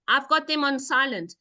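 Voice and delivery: usual speaking voice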